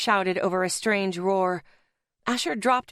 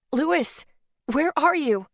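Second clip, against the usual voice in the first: higher-pitched anxious call; urgent calling out; 6 semitones higher, slower than usual. A narrator's reading. Searching the wrecked room for Louis, urgent raised-pitch call. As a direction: call out for someone missing.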